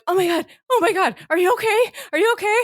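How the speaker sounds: imitating a panicky friend, high and urgent; high-pitched mock panic, words tumbling out; retold frantic check on a fallen friend; freaked-out friend. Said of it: "and also freaked out".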